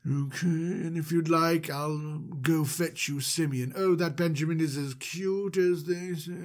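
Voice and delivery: slightly odd, drawn-out host voice; mildly creepy, unhurried character voice; Mister Manager. Acted. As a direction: lightly put on a slow, strange voice. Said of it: "his voice sounds a little different".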